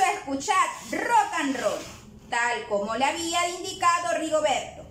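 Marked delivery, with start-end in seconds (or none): none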